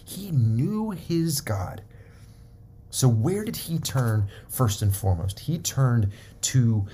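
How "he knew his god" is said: "knew" lifted high and slowed right down; stress on knew. Shown as a demonstration. Preacher contrasting knowing about God with knowing him, slow stress on "knew". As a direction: land hard on "knew", slowly. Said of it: "He didn't just know about God".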